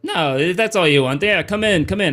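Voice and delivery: lilting put-on Weequay accent; wary doorman with a Weequay accent. Doorman letting watched newcomers in, Weequay accent. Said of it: "this is how all Weequay sound".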